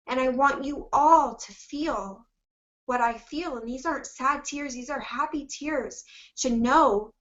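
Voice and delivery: real emotional catch, slowed and teary; holding back tears. Real, not acted. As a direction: fight the tears while speaking. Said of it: "I'm trying so hard not to cry".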